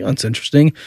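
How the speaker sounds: ordinary talk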